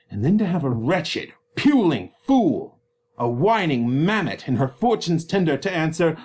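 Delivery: loud, angry father tearing into his daughter; furious Capulet berating his disobedient daughter; raging father Capulet. Acted. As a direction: roar at her like an enraged father. Capulet rages on.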